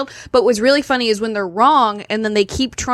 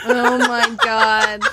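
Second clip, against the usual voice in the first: laughing; 10 semitones higher, much slower than usual. Real, not acted. Laughing at cohost's prediction.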